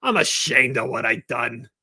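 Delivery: a comic, hammed-up shame; ashamed Don Zimmer. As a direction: apologize like a scolded old man. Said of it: "And he'd get up and go".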